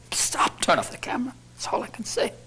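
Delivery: hushed, pretend-embarrassed aside; mock secret whisper. Comedian admitting he's a comedian, joking whisper to the crew. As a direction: whisper it as if caught out. Confession, asks camera off.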